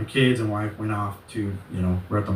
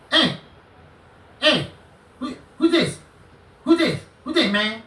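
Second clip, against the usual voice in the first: high-pitched, agitated imitation of the caller; mock a worked-up man, voice jumping way up; mimicking his screaming; 13 semitones higher than usual. Imitating the angry man answering his call, high mocking shout. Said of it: "He went like that, right? That's how he sounded".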